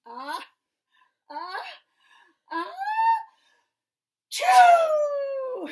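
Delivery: building ah-ah-ah into a huge shouted choo; bear's giant sneeze; big sneeze. Acted. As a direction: wind up the sneeze and explode it. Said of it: "and he went".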